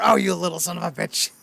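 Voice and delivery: imitating an angry bartender; a hint of Moe's snarl; mock angry Moe. Moe gets mad at Bart, mock angry growl. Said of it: "Then Moe gets mad at Bart".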